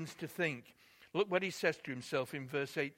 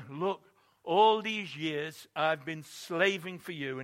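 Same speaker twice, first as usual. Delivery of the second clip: slow, louder, bitter protest; resentful complaint; 7 dB louder, slower than usual. Retold or imitated. Elder son protests to his father, slow and resentful. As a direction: drag out the grievance, louder and slow.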